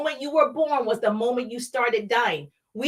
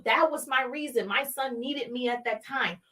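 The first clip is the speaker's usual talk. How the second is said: normal talking voice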